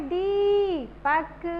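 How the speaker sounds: Deepak with a long, held दी; stress on दी. Shown as a demonstration. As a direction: stretch the ee of Deepak, then drop on पक. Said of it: "दा पे जोर देके बोलना है". (दीपक में दी को लंबा खींचकर)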